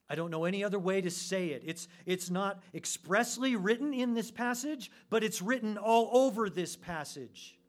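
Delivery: loud, high, forceful pulpit voice; impassioned preaching. Real, not acted. Preacher warning listeners against imagining themselves humble, loud and insistent. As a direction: drive each phrase out loud and high.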